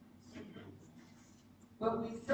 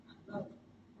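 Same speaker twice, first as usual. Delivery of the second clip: soft "I love you"; 17 dB quieter than usual. Retold or imitated. To a partner mid-argument, a soft near-whispered "I love you". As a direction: drop to a near whisper, tender.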